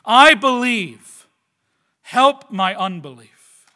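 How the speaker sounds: loud, slow acted cry of faith. Father of the child cries out to Jesus, loud and slow. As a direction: cry it out slowly, with force. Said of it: "he cried out, right?".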